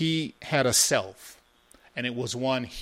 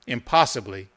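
plain reading voice

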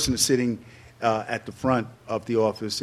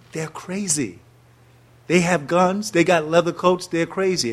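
alarmed thought; 5 semitones higher, 4 dB louder, slower than usual. Retold or imitated. Teen watching Panthers on TV.

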